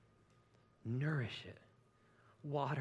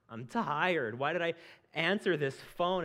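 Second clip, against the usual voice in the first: weary complaint; 6 semitones higher, 6 dB louder, slower than usual. Retold or imitated. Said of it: "And I'm sitting there going".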